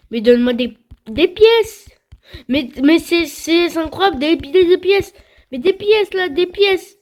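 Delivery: high, loud pleading for coins; excited begging. Real, not acted. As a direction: beg the game, rising hype.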